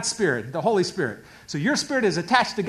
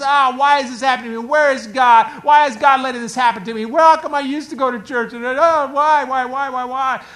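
high, fast, louder whiny complaint; whining baby soul; 5 semitones higher, 7 dB louder than usual. Acted. The soul whining to God like a baby. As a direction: whine like a spoiled child. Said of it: "and it whines, and it cries".

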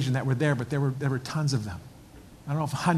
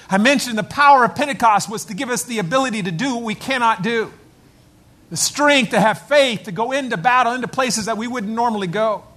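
preaching at full force; 7 semitones higher, 6 dB louder than usual. Real, not acted. Pastor proclaiming the veterans' readiness to the church, fervent. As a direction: build the fire, proclaim it.

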